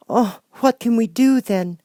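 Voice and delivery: put-on high, worried child voice; girl voice. Dorothy asking friends what to do, high worried girl. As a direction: ask anxiously.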